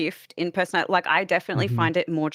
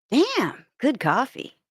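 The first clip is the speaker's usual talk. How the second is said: put-on Twin Peaks-style American accent, high and bright; 6 semitones higher, slower than usual. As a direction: drawl it like an American agent. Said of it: "read Twitch chat in accent".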